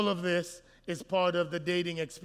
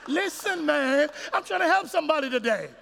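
higher, fast urgent preaching; fervent plea; 6 semitones higher, 4 dB louder than usual. Real, not acted. Preacher pleading with distracted crowd, urgent and high.

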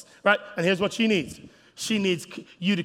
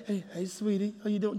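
tender greeting; 2 semitones higher, 6 dB quieter than usual. Retold or imitated. Husband greeting wife tenderly.

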